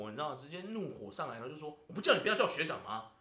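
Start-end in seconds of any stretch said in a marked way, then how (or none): 1.9-3.1 louder, fast mock scolding; retold angry snap. Coworker scolding him for saying xuezhang, mock angry. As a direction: bark it like an irritated senior. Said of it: "就很生气耶".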